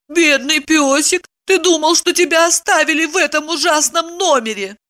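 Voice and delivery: high-pitched pitying coo; cooing pet talk. A narrator's reading. Woman consoling her dog, cooing. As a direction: pity the doggie, ignoring the man. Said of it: "Евдокия продолжила".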